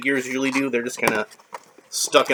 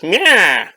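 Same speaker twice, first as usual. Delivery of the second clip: mock meow; 5 semitones higher, 5 dB louder than usual. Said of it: "Skeletor literally says".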